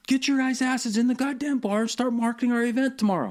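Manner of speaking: high-pitched imitation of a bossy veteran barking orders; mock yell as Hovland. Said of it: "And he's like".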